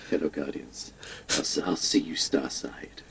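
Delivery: low, soft late-night radio announcer; soothing low radio host sign-off. Retold or imitated. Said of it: "He's like".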